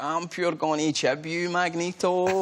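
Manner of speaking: put-on slow Glaswegian Ned accent. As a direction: tough Glasgow ned threatening, slowly. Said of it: "that was proper Neddy Glaswegian Barry".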